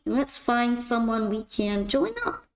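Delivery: normal talking voice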